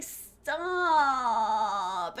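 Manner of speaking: long, drawn-out whine; imitation of a villain's drawn-out whine; Albert Wesker whining. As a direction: stretch one word into a whiny, falling moan. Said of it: "the whine".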